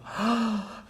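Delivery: sharp, high joyful gasp; a high gasp of glad surprise; surprised gasp. Retold or imitated. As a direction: gasp as if seeing a lost friend. Queens find Jambavati.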